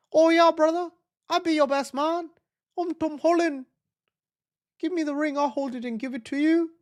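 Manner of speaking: high-pitched attempt at a British accent; British accent as Tom Holland. Streamer plays Tom Holland agreeing to be best man, British accent. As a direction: put on a light British accent, pitched well up. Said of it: "Is that a good British accent?".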